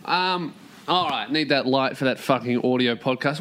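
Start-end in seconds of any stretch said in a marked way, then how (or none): none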